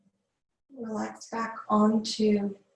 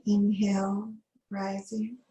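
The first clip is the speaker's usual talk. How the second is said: guided meditation. Acted. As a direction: ease the class into calm breathing. Yoga teacher leads breathwork.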